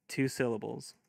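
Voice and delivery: normal talking voice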